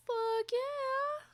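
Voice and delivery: high, loud, drawn-out whoop; elated high-pitched approval; excited cheer. Real, not acted. Cheering viewer's hot chocolate.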